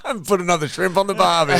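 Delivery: laughing Aussie cliché; Australian accent. Pretend. Friend joining the accent joke, laughing Australian accent. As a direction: put on a jokey Australian twang, amused.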